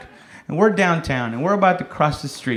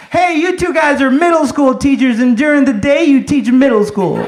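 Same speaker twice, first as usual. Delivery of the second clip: higher, louder heckle yelled at two passers-by; yelled taunt; 8 semitones higher, 4 dB louder than usual. Acted. Comic hypothetical kid taunting two friends, yelled out. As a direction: call it out like an insult from a car. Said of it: "if he had yelled out".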